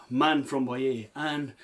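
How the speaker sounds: ordinary delivery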